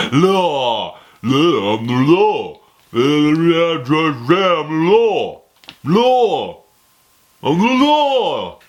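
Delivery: loud, garbled hammed-up bellow; Judge Dredd. Reviewer milking a film catchphrase, mock shouting. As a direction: keep howling the catchphrase, bigger each time. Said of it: "You know what I'm talking about".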